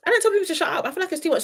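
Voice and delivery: high-pitched objection; scandalised, insistent complaint; indignant protest. Real, not acted. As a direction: push the pitch up as you protest. Indignant about saying shut up.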